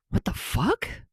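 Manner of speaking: high, shocked, rough; rough disbelieving outburst; rough shocked question. A narrator's reading. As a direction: shoot it out in disbelief. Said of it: "he asked roughly".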